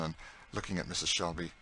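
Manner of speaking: plain reading voice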